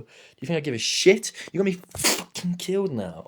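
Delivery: gaming rage. Real, not acted. Dying in Call of Duty mid-story, real frustrated outburst. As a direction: snap at whoever just got him killed. Raging at the game mid-play.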